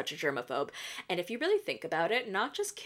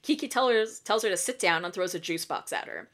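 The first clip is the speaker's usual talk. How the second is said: ordinary talk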